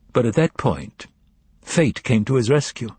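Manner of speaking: ordinary delivery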